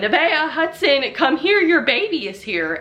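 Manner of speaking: put-on mother-in-law calling the kids to meet the baby. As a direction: call the kids in, excited.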